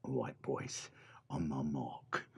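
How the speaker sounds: low whispered command; whispering. Acted. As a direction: whisper the order. Whispered orders.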